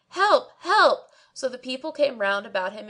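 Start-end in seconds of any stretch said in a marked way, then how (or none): 0.1-1.1 high, loud cries for help; shrieking for help. A narrator's reading. Barber shrieking to the street, high and loud. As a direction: shriek it out in panic. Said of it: "kept on shrieking and crying".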